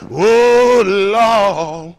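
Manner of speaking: a churchgoer wailing to Jesus in mock despair; mock wailing cry. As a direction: cry out to the Lord like a hammed-up churchgoer. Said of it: "praying and praying".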